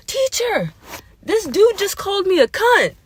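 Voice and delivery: high, screechy schoolgirl tattling; mock scream. Fourth-grade girl screaming to the teacher, high-pitched. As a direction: imitate a kid screaming out a complaint. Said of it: "And then she finally screams".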